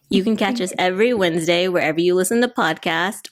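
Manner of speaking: usual speaking voice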